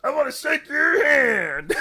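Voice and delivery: high, loud, drawn-out gritty bellow; gritty shout. Acted. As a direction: belt it out slowly with grit and authority. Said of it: "give your voice a little bit of grit".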